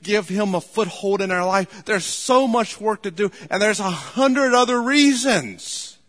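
loud, high-pitched urgent preaching; impassioned pulpit exhortation, rising in pitch; full-force preaching. Real, not acted.

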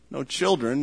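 usual speaking voice

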